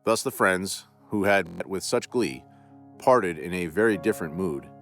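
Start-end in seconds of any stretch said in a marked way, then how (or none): none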